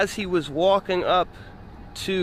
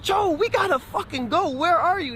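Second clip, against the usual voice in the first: high, strained retold shouting; frustrated yell; 14 semitones higher than usual. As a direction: hurry him up, fed up. Urging slow friend on phone.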